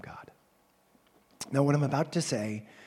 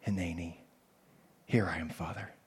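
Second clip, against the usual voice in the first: soft prayer; 5 semitones lower, 3 dB quieter than usual. Acted. Voicing a prayer response.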